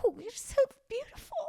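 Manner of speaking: high-pitched mock weeping; Will Ferrell. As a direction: gush as if crying at beauty. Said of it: "It's like crying".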